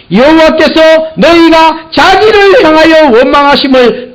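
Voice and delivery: high, loud preaching cry; preaching at full force. Acted. Preacher crying out to the congregation, high and loud. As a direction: build it up and cry it out.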